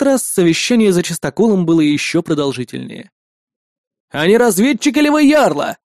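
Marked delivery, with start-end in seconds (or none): 4.1-5.8 high loud suspicious call; shout. A narrator's reading. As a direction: shout a demand from the wall. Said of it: "крикнул бородач". (крик бородача со стены)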